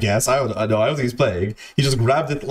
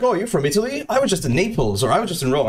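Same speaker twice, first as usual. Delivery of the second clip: high, chatty tourist imitation; Aiden; 7 semitones higher than usual. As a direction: mimic eager small talk in a lifted voice. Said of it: "Aiden's like".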